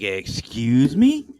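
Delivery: loud, drawn-out disbelief; incredulous. Retold or imitated. Retelling the shock of finding new episodes, loudly incredulous. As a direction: stretch it out in mock outrage.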